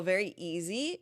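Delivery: ordinary delivery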